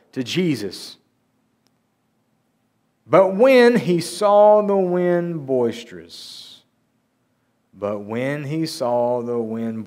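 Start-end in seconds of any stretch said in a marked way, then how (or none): 3.1-6.6 slow, loud, higher, drawn-out reading; dramatic scripture reading. A narrator's reading. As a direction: stretch the verse out with big, raised drama. Dramatic reading of verse, then repeated plainly.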